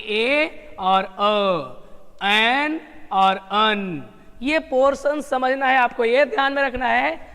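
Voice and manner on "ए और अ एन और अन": slow, drawn-out demonstration of ए, अ, एन, अन; slow demo. Shown as a demonstration. Teacher slowly sounds out ए and अ, एन and अन. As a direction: stretch each sound out slowly for the class. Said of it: "इसका portion ये होगा".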